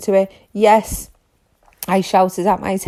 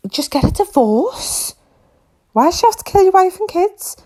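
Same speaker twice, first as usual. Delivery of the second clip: exasperated outburst; 11 semitones higher than usual. Real, not acted. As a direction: throw your hands up in disbelief.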